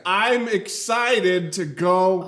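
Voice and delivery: slow, loud, high over-enunciation; mock learner speech. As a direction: labor proudly through each word like a beginner. Imitating a learner speaking careful phrases.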